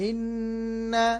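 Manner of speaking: ইন্না slow, nn held in the nose; nasal ghunna. Shown as a demonstration. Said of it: "এখানে নু গুন্না হবে".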